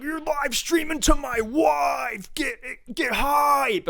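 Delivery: high, slow shouted hype voice; hype man. Retold or imitated. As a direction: yell it like a pumped-up fan. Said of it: "This dude's like".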